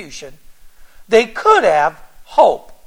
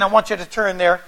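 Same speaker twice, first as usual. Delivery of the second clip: normal talking voice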